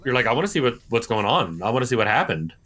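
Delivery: normal talking voice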